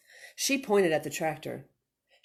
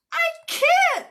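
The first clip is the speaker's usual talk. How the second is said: high, loud yell; 7 dB louder than usual. Acted. Moo yells to Minnie from the runaway tractor. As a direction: cry it out, out of control. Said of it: "yelled Moo".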